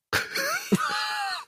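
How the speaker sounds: high burst of laughter. Real, not acted. Laughing after performance. (lautes Lachen)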